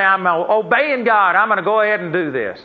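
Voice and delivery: lightly hammed-up churchgoer boasting; proud testimony. Churchgoer proudly announcing his gift to the congregation, mock proud. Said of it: "they get up and say".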